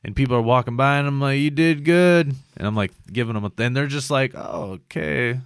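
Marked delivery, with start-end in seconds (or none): 1.4-2.3 high, loud, gushing congratulation; over-keen mock praise. Acts out groggy praise to passers-by. 4.3-5.4 slow, higher, hesitant acknowledgement; awkward polite reply. Retold or imitated. Voices baffled strangers' reply.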